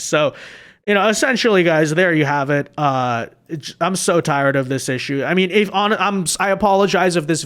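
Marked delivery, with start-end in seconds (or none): none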